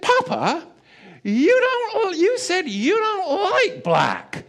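put-on a toddler innocently calling Dad out; child's voice. Small son reminding Papa in the store, high slow childlike voice.

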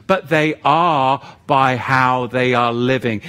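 normal talking voice

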